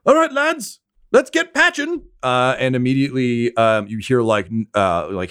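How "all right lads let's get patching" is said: yelled order. Acted. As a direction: shout up to the lads. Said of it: "you hear one of them yell".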